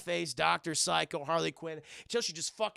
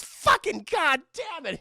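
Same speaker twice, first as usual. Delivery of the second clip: high, loud, drawn-out mock curse; acted fed-up outburst; exasperated cursing; 10 semitones higher, 7 dB louder, slower than usual. Dwarf forced into the vent, exasperated cursing.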